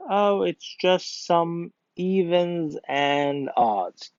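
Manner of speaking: evens and odds, slow and drawn out; wrong idiom read. Shown as a demonstration. Teacher reading the wrong idiom to the class, slow and drawn out. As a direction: read out the wrong version slowly. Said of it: "I said it like evens".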